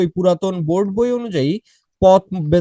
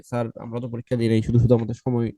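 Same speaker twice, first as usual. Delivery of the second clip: mock annoyed student; 8 semitones lower, 3 dB quieter than usual. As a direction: whine it like a fed-up pupil. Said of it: "তারা বিরক্ত হচ্ছিলেন যে".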